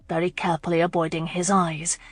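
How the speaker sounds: plain reading voice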